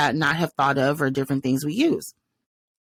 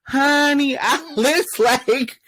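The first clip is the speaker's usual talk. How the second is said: excited laughing aside; 10 semitones higher, 3 dB louder, much slower than usual. Real, not acted. Excited reaction, high and laughing.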